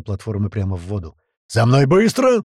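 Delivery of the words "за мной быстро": loud, high, bellowed command; elephant-like roar. Acted. Vanya leads the men off, roaring. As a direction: trumpet it like an elephant. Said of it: "проревел слоном Ваня".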